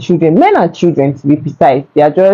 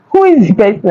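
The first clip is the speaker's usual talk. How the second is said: much higher, half-laughing disbelief; incredulous, laughing; 12 semitones higher, faster than usual. Retold or imitated. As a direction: ask in stunned amusement who it could be. Shocked at being blamed.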